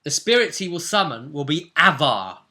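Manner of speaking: normal talking voice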